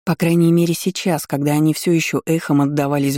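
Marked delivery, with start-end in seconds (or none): none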